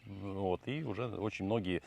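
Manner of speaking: ordinary talk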